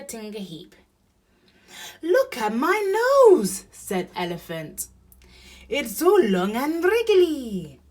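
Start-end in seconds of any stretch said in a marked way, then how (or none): 2.0-3.6 very high, loud cry; astonished Elephant. A narrator's reading. Elephant astonished by new nose. 5.7-7.8 high, loud marvelling; amazed Elephant. A narrator's reading.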